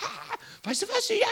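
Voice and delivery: high, laughing excited call. Pretend. Calling a friend after good news from the doctor, laughing excitement. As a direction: bubble over with relief and joy. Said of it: "du rufst gleich jemand an und sagt".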